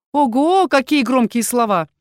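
высокий, громкий, издевательский голосок; протяни с деланым удивлением, гадко и высоко; мерзкий голосок. A narrator's reading. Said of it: "послышался знакомый нам мерзкий голосок". (мерзким насмешливым голоском)

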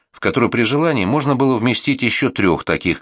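ordinary delivery, nothing marked

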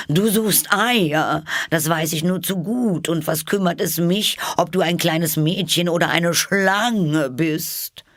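high pigeon voice, accusing; suspicious pigeon. A narrator's reading.

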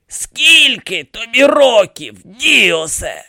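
high, slow creaky question; creaking demand. A narrator's reading. Dead pharaoh demands the priest's age, creaking accusingly. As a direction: draw the question out as an accusing old mummy. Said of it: "проскрипів він".

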